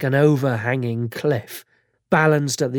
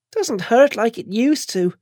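put-on high, tearful, whiny girl; a bandaged girl fighting tears; whiny young woman; 8 semitones higher than usual. Chantal voiced.

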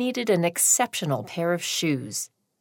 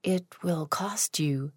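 slow, hushed whisper of a warning; the clerk whispers a price warning; 7 dB quieter, slower than usual. Acted. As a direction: breathe it like a secret. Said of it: "The clerk whispered".

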